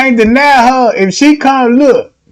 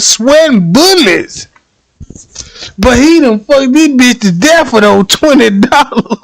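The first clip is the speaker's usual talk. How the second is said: usual speaking voice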